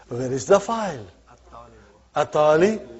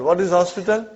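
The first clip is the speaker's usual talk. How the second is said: ordinary talk, nothing marked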